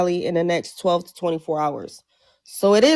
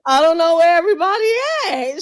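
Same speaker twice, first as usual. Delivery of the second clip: laugh-crying; 7 semitones higher, 4 dB louder, faster than usual. Real, not acted. Laughing disbelief, everyone absent.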